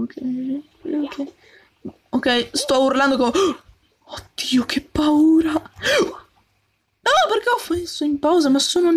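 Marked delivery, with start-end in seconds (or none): 4.1-4.6 breathless whispered oddio; scared whisper. Real, not acted. Player startled by an enemy, whispered oddio. 4.6-5.5 a yelp of che paura; frightened cry. Real, not acted. Startled gamer after a gasp, frightened cry. Sudden scare in game.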